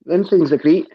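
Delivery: playful Scots put-on; Glaswegian accent. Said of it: "put on a Glaswegian accent".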